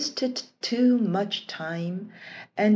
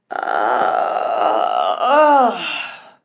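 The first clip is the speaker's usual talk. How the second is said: genie yawning; 4 semitones lower, 6 dB louder than usual. A narrator's reading. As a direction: yawn loudly as you wake. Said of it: "The Jenny yawned and stretched".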